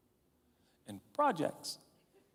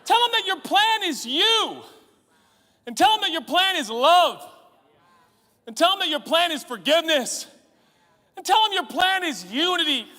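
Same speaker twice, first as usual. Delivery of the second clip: higher, louder, pleading prayer; loud car prayer; 8 semitones higher, 5 dB louder than usual. Retold or imitated. Preacher reenacting his prayer in the car, loud and pleading. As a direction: cry out to God from the car. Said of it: "I'd be like".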